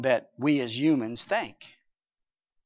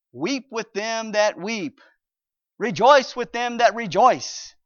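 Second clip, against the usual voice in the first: scripture quote; 6 semitones higher, 8 dB louder, slower than usual. Acted.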